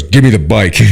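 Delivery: loud retold order from a fed-up dad; demanding shout. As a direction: bark it out like an angry dad, laughter close behind. Acts out dad's angry demand.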